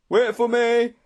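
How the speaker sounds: high, loud desperate call; panted plea. A narrator's reading. Straggler begging friends to wait, panting plea. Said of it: "a voice behind us panted".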